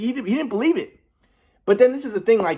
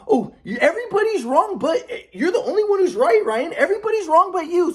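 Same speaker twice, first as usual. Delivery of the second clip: high mocking old man voice with a laugh; mock him as the only one who's right; sarcastic grandpa; 11 semitones higher than usual. Grandpa putting grandson down in argument, sarcastic. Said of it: "So he'd be sarcastic".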